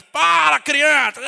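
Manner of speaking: harsh shout. Retold or imitated. Parent yelling at a crying child, harsh.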